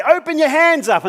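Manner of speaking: high, loud called-out order; shouted instruction. Retold or imitated. Instructor shouting down to the abseiler, high and loud. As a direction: call it down the wall. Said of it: "I'd say".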